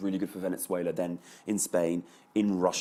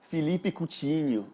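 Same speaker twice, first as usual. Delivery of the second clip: name drawn out slowly in a Portuguese accent, pitched up; put-on Portuguese pronunciation, higher and slow; 8 semitones higher, slower than usual. Shown as a demonstration. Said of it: "Can I say it with an accent?".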